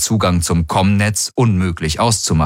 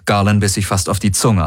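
plain reading voice

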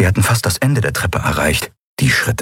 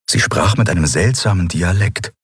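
plain reading voice

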